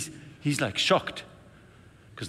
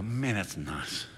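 slow appreciative; 3 semitones lower, 7 dB quieter, much slower than usual. Retold or imitated. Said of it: "I go".

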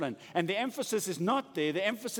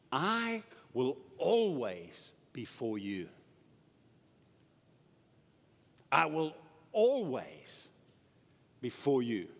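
slow tender promise; 4 semitones lower, slower than usual. Acted. God's promise to his disciples.

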